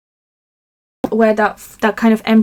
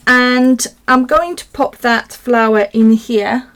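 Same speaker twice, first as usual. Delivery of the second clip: ordinary talk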